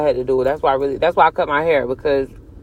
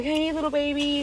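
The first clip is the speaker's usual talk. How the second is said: cooing stranger; 8 semitones higher, 9 dB quieter than usual. Retold or imitated.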